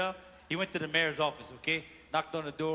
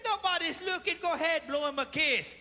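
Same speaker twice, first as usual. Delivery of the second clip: higher, coaxing husband's voice; 7 semitones higher than usual. Retold or imitated. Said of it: "He said".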